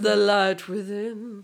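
slow, drawn-out mystical voice. Pretend. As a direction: intone it like an oracle. Slower mystical echo.

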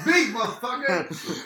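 laughing. Real, not acted. Laughing about barking like a dog.

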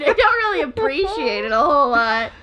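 loud, high exasperated complaint; mad but joking. Real, not acted. Complaining to a co-host who keeps busting balls, loud indignation. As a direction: scold them with a laugh breaking in. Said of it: "actually I'm really fucking mad".

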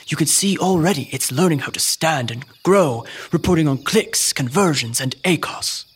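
wildlife narrator voice; nature documentary. Pretend. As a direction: describe it like a nature film. Co-host continues documentary parody.

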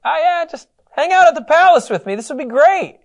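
high, breezy sarcastic offer; mock cheerful invitation. Preacher mocking the lord's polite request with sarcasm. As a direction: make prison sound like a party. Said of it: "I love how they phrase".

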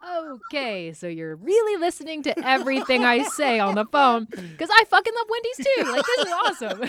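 high, loud, giggling delight; laughing mom. Retold or imitated. Mom cracking up at her toddler's swearing, high and loud. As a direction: laugh along excitedly with your kid. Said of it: "And I'm like".

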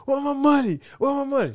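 high, crying plea over lost money; mock crying. Kid crying to auntie about his missing money, high and tearful. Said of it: "So I'm crying like".

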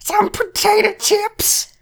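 very high, slow, wailing squeal; shrieking Mrs Potato Head. Retold or imitated. Mocking Mrs Potato Head packing for her husband, wailing squeal. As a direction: caricature her packing list as a crying wail. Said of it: "she yells a lot".

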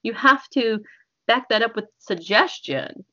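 ordinary delivery

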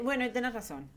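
usual speaking voice

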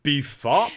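B F O P run together as Beefop. Retold or imitated. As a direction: say Beefop as one word. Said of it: "as Adam would say".

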